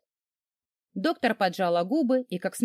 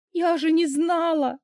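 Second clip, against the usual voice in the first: sobbing; 8 semitones higher, slower than usual. A narrator's reading. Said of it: "схлипнула я".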